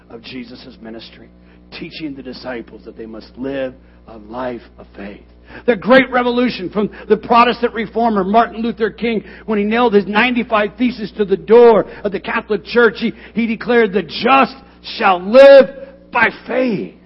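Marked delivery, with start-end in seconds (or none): 5.7-17.0 full-force preaching. Acted. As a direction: thunder the point home to the church. Preaching Luther's declaration.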